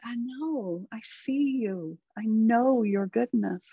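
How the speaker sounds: soft, slow soothing reply; hushed and slow, full of understanding; tender reassurance. Acted. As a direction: gently comfort an upset inner self. Soothes her upset inner self.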